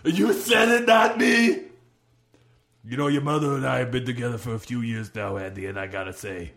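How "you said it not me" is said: high, loud gleeful yelling; gleeful shout. Acted. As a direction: crow it out twice, loud and high.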